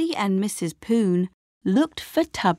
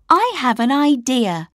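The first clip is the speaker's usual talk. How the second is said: eager, higher and louder; bright idea; 6 semitones higher, 5 dB louder than usual. A narrator's reading. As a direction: announce the idea with excitement. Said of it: "said Lucy".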